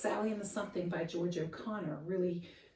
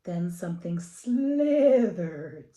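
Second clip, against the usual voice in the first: stretched, louder, building dread; slow spooky; 10 dB louder, much slower than usual. A narrator's reading. To the children, a slow spooky reveal, drawn out.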